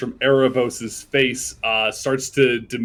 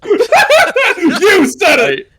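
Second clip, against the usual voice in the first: breaking up with laughter; laughing; 9 dB louder, much slower than usual. Real, not acted. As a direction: crack up while saying it. Narrator cracks up at joke.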